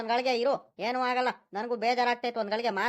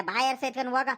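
usual speaking voice

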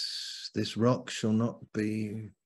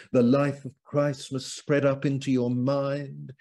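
impassioned preaching; 5 semitones higher, 5 dB louder than usual. Real, not acted. Preacher urging the life of Christ on the congregation, impassioned. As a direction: preach it with mounting force.

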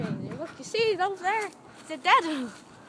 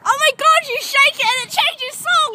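high loud delighted cry; excited; 9 semitones higher, 9 dB louder than usual. Real, not acted. Thrilled that shaking the phone changes the song, loud and high. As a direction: burst out at the discovery.

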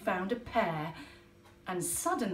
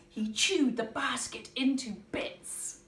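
plain reading voice

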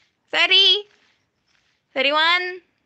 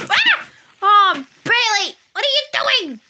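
higher, louder startled cry; 6 semitones higher, 5 dB louder than usual. Real, not acted. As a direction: jump at the sight of her. Startled by player Bailey.

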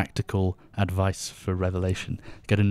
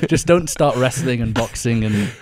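teasing; 4 semitones higher, 8 dB louder than usual. Acted. Teasing about YouTuber antics.

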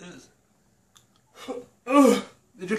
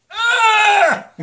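loud high cry of pain; pained shout; 10 dB louder than usual. Real, not acted. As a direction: yelp out in burning pain. Chili burn peaking.

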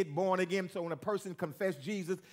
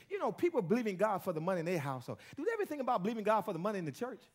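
ordinary delivery

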